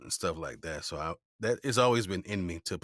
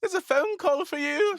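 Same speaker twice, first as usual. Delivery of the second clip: put-on very high, loud little British boy; British-accented child; 10 dB louder than usual. Ten-year-old answering the house phone, high British accent. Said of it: "this is me at ten".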